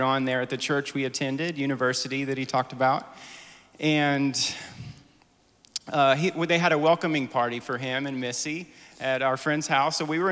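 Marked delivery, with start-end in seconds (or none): none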